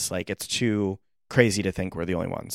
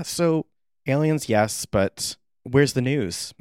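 usual speaking voice

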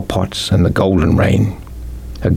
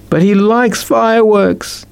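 put-on much higher, slow wailing little boy; mild bawl of a little boy; bawling child; slower than usual. A narrator's reading. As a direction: wail it like a small child. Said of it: "B B bawled".

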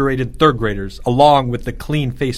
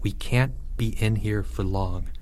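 hushed, softer voice; whispering; 3 semitones lower, 8 dB quieter than usual. A narrator's reading. Leo to his trapped soldiers, whispering. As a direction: keep it down, almost whispered. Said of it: "Leo whispered".

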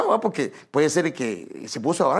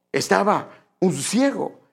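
normal talking voice

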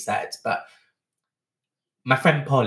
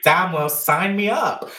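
high, loud, slowed-down enthusiasm; eager reaction; 7 semitones higher, 5 dB louder, slower than usual. Retold or imitated. Speaker recalling being hooked on the party scene, eagerly. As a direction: relive it loudly, stretching the words.

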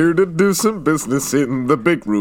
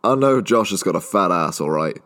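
normal talking voice

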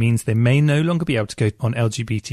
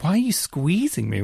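mock puzzled; 6 semitones higher than usual. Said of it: "he was like".

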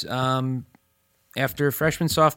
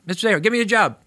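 higher, louder nagging plea; pestering demand; 9 semitones higher, 5 dB louder, much faster than usual. Retold or imitated. Student pestering teacher for a job, nagging. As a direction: keep badgering him for a job. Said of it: "I said".

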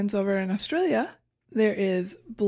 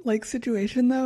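ordinary talk

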